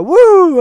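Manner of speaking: high, loud wrestling woo; Ric Flair woo. Retold or imitated. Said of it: "Ric Flair".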